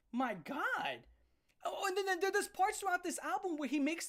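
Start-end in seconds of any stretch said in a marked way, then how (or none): none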